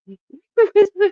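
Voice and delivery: shrieking laugh burst; loud high laugh. Real, not acted. Laughing at her own joke.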